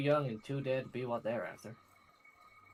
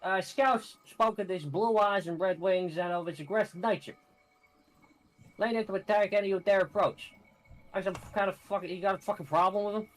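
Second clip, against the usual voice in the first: high, loud New York tough guy; New York accent Gavin; 5 semitones higher, 4 dB louder than usual. Acted. Gavin in a crude New York accent talking to the adventurer. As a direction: swagger through it, New York style. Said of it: "give him the New York".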